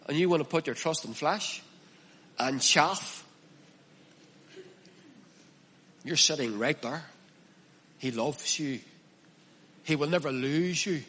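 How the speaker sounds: a preacher softly reassuring the listener he is loved; soft tender reassurance. Real, not acted. Hushed and tender. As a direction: drop the voice and tell them softly they are held.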